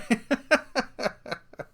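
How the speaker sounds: high chuckling laugh; real laugh. Real, not acted. Comedian cracking up after a marriage joke, real laugh. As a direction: laugh at your own joke.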